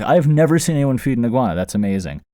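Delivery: fast, loud and high excitement; amazed. Retold or imitated. To his ex-girlfriend about her video, amazed. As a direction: gush about it. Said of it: "and I was like".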